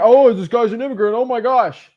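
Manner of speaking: high, loud mock-outraged gasp of disbelief; mock horror. Coach mimics people who can't process an immigrant buyer, mock horror. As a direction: exclaim it like a scandalized bigot, hammed-up.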